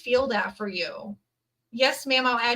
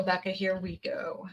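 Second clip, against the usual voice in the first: whisper; 8 dB quieter than usual. Real, not acted. Reader whispers the name to herself.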